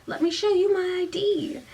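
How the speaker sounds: high, sweet Southern belle; put-on Southern accent. A narrator's reading. Faked Southern girl bluffing the doorman.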